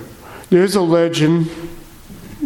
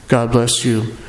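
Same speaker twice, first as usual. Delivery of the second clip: normal talking voice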